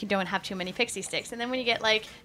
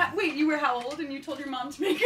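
incredulous laugh; 7 semitones higher, 7 dB louder than usual. Real, not acted. Incredulous at five-year-old baker.

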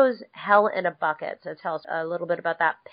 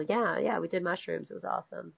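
much softer, trailing off; fading mock brag; 10 dB quieter than usual. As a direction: let the fib die away. Fibbing, voice sinking away.

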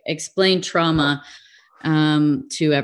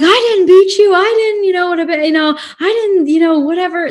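high, loud mock indignation; defensive parent; 12 semitones higher, 6 dB louder, faster than usual. Parent denying they ever beat their child, mock outrage. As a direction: protest shrilly like an accused parent.

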